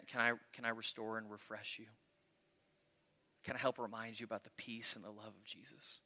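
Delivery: very soft, hushed offer to help; almost whispered tenderness, hesitant and kind; gentle, not screaming. Retold or imitated. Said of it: "I'm not gonna come all up in your business, screaming at you".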